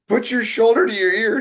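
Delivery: high, loud imitation of a coach's yell; mock yelling. Recalling being yelled at over a shoulder position, mock yelling. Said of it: "You were yelling at me".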